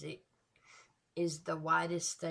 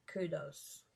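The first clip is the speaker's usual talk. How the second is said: ordinary talk, nothing marked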